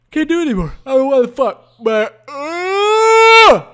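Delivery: high, loud yawn swallowing the words; words stretched by a yawn; talking through a yawn. Real, not acted. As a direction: let a huge yawn take over the sentence. Yawning while talking, cannot stop.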